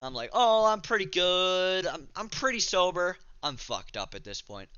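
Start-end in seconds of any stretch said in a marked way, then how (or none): 0.3-3.2 feigning soberness. Retold or imitated. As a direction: stretch it out loudly, playing it cool. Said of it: "didn't wanna seem like a bitch".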